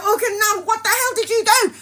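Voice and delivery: imitating a panicked mum; alarmed scolding mum. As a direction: tell her off in panic.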